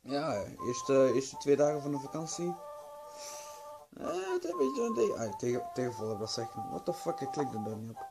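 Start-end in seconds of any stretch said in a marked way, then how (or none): none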